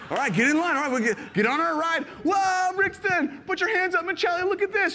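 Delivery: high, loud, excited cheer; rally the kids like it's the best ride ever; hyped-up dad. Retold or imitated. Dad cheering his kids onto the parking tram, high and excited.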